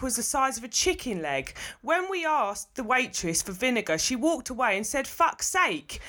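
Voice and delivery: put-on Essex accent. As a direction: read it as an outraged Essex reviewer. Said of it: "do it with an Essex accent".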